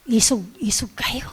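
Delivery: whispered, no voice at all; hushed warning. Retold or imitated. Mother whispering "isog" to the kids about the possessed. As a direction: breathe "isog" as a secret warning.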